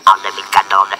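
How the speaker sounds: ordinary talk, nothing marked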